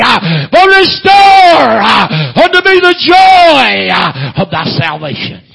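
building from loud cadence to high shouting; full-force preaching. Real, not acted. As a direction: whoop it out with a gasped "hah" after phrases.

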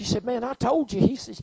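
a fiery preacher shouting the story; preaching at full force. Acted. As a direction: belt it out to the congregation. Preaching climax.

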